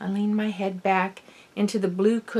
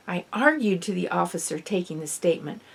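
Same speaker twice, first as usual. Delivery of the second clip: ordinary delivery, nothing marked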